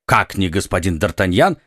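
ordinary delivery, nothing marked

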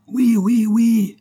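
high, loud cheering cry; crowd shouting. A narrator's reading. Thousands answer the call to take the Bastille, shouting. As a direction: shout yes like an eager crowd. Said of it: "crièrent trois mille ou quatre mille voix".

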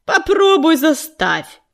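медленно, с вызовом; процедить сквозь зубы, нехотя; огрызаясь. A narrator's reading. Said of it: "огрызнулся полосатый воин". (огрызнулся на предводительницу, медленно)

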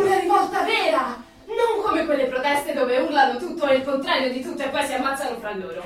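burning to rebel; loud rebellious fervour; passionate. Acted. Passionate call for real revolt.

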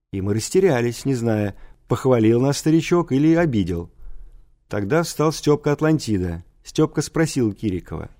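usual speaking voice

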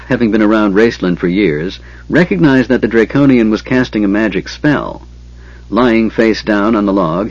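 plain reading voice

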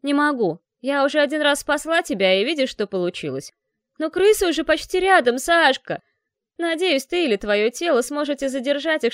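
loud, jeering call while running; mocking shout. A narrator's reading. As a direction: shout it back with a sneer. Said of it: "Издевательски прокричало эхо". (издевательский крик на бегу)

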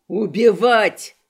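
slow, loud drawn-out cry; loud echoing shout. Acted. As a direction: bellow it back like an echo. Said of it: "словно эхом откликнулся Криштоф".

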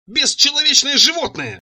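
high, drawn-out outraged insult; enraged. A narrator's reading. Furious Цира struggling with him in the doorway, enraged. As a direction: fling the insult out slowly, voice pitched high. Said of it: "разъярённая".

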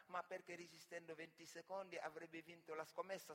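normal talking voice

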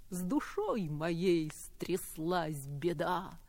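slow, soft verse reading; poem recital. Acted. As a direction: read it tenderly, slowly.

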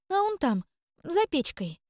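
высоким чужим голосом, неуверенно запинаясь; замяться, отвечая тонким голоском; замявшись. Acted. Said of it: "замялась Кикимора". (Кикимора мнётся, отвечая хозяйке тонким голосом)

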